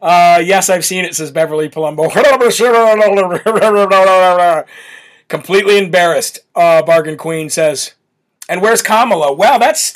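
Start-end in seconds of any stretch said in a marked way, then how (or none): 2.2-4.6 long slurred babble; mock gibberish. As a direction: imitate talking in cursive. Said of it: "He talks in cursive".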